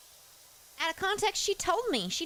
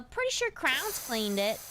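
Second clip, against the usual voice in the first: ordinary talk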